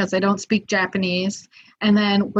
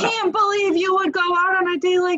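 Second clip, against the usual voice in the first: angry friend; 10 semitones higher than usual. Retold or imitated. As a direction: play the furious rescuer, half laughing. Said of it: "he's like".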